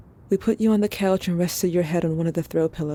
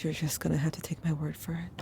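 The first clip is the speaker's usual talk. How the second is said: hushed, confiding whisper; secretive whisper; 9 dB quieter than usual. Acted. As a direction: lean in and whisper it to Ash. Confiding to Ash.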